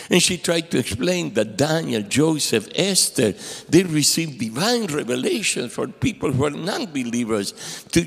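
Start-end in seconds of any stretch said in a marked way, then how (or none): none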